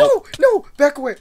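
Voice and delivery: high, loud warning cry; panicked shout. Real, not acted. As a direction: yell to keep them off you. Fending off another player.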